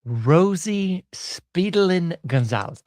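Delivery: slow, deliberate, each word of the name drawn out; slow, savoring. Real, not acted. Host reading the judge's name aloud to viewers, slow and savoring. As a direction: savor every syllable of the name. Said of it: "I'm gonna let it play with you".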